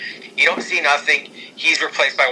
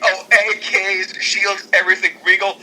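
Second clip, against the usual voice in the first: ordinary talk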